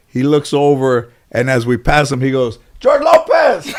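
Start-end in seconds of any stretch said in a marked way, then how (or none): 2.8-3.7 high-pitched mock yell of a name; homeless man's yell. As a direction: yell it way up high, imitating a passer-by. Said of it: "The homeless guy yelled your name".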